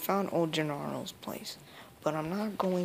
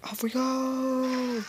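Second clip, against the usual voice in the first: higher, drawn-out happy call; cheery send-off; 8 semitones higher, 5 dB louder, much slower than usual. Real, not acted. Streamer setting off on the quest, cheerful. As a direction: sing it out as you set off.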